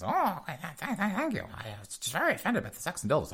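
high stuttering squeak, flustered and fast; mock stammering falsetto. Fraudster thanking the manager, high flustered stammer. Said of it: "And the guy's".

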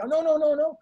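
high, hurried string of refusals; insistent protest. Retold or imitated. Coach overrules her offer about the jacket, insistent protest.

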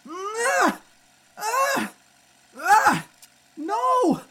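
high, effortful struggle cries; straining cries. Acted. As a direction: fight the restraints, crying out. Struggling against straps.

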